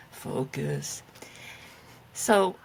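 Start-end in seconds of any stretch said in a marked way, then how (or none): 0.1-1.0 low growling demon voice; a hint of a demon teacher. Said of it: "in a demon voice?".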